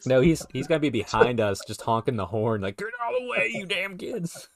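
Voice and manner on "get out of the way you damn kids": high, strained holler at runners; hammed-up, high-pitched shout; mock angry yell. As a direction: yell like a cranky driver chasing off kids. Acting Matt yelling from behind runners.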